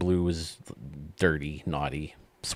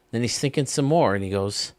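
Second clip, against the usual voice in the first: ordinary delivery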